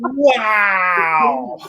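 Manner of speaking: long, wailing mock-amazed wow; exaggerated gawping "wow" that slides down; awestruck kid imitation. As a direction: draw the word right out, hammed-up wonder. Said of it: "He's like".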